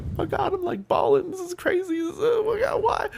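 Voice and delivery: high, mocking wail of a crying viewer; mock bawling chat. Streamer mimicking viewers in chat crying, high and mocking. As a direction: squeak it out like an overemotional fan. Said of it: "in the chat saying like".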